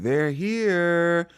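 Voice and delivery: high, drawn-out mock announcement. Retelling a brother spotting his siblings, mock announcing. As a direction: call it out high, imitating.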